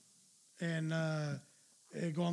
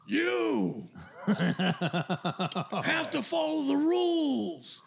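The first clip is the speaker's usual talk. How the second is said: mock angry dad; 7 semitones higher, much slower than usual. Angry dad scolds kid.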